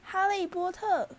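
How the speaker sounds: drawn-out, piercingly high elf squeal; high, shrill creature. A narrator's reading. Creature greeting Harry so loud that downstairs could hear, shrilly. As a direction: cry the name out high and slow, awestruck. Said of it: "那个生物的嗓门又高又尖".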